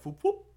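high, loud whoop whoop noise; goofy sound effect; silly popping noise. Retold or imitated. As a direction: mimic a quick pop in and out. Imitating turd popping out.

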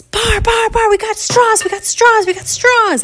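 put-on very high, loud kid's squeal; ridiculously excited. Candy runs in to tell Bar, squealing with excitement. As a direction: shout the great news in breathless repetition. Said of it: "Candy is, like, ridiculously excited".

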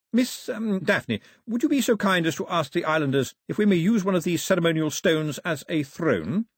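high, formal courtly request. Acted. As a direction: ask with careful official politeness.

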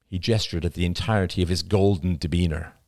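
ordinary delivery, nothing marked

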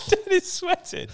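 very high, laughing squeal. Real, not acted. Laughing at sweating friend.

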